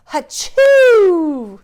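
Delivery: very loud high hachoo; a big theatrical sneeze; Father Christmas sneezing loudly; huge sneeze. Acted. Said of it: "sneezed Father Christmas".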